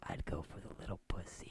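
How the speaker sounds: whispered, soft, grinning; sly whisper. Retold or imitated. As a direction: lean in and whisper it slyly. Guy grinning says his answer.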